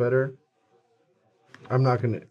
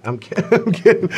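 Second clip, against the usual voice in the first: high, loud, breaking up mid-word; real laughter bubbling through the words; laughing; 11 semitones higher, 9 dB louder than usual. Real, not acted. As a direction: crack up while saying you're joking. Joking, bursts out laughing.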